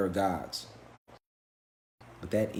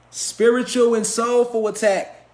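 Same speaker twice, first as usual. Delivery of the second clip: high, loud and slow, each word hammered; emphatic preaching; 11 semitones higher, 9 dB louder, slower than usual. Real, not acted.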